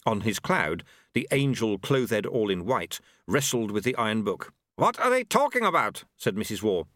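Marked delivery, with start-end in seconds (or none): 4.8-6.0 high-pitched indignant question; querulous character voice. A narrator's reading. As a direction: ask it high and put out. Character's puzzled question in narration.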